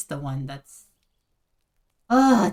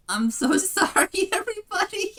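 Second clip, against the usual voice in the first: laughing apology; 11 semitones higher, 6 dB louder than usual. Real, not acted. Streamer apologising to everybody for the blast, laughing. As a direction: apologise through rising giggles.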